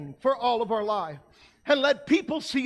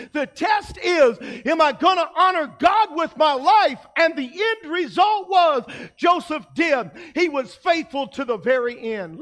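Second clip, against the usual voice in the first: preaching at full force; 4 semitones higher, 5 dB louder than usual. Acted. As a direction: call the whole church to decide.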